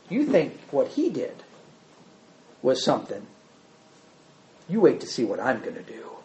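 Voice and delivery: low, soft, menacing brag; Rehoboam's quiet boast. Acted. New king answers the people about his father, quietly boasting. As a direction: boast quietly, letting pauses hang. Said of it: "what does Rehoboam say?".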